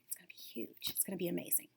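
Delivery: soft secretive whisper, almost unvoiced. Real, not acted. Teacher whispering a promise to her students about their dreams. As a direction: hush it like sharing a secret.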